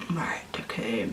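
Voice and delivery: breathless whisper to herself. Retold or imitated. Pupil reacting to hearing her name, in a whisper. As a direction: whisper it, frozen in place.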